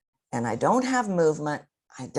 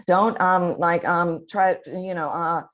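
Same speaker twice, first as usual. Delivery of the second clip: louder, halting demo of ums and uhs; filler-word stumbling; 2 semitones higher, 5 dB louder than usual. Shown as a demonstration. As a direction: stumble through fillers as if searching for words. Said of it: "that's what's gonna happen".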